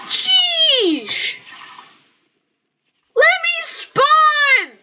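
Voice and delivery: high, slow whining protest; frustrated gamer cry; exasperated yell. Real, not acted. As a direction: wail it at the game, dragging the words. Frustrated at being killed on spawning.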